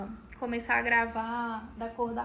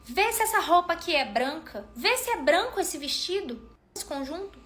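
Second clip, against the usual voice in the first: mocking relative; 5 semitones higher, 3 dB louder than usual. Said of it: "ela falou assim".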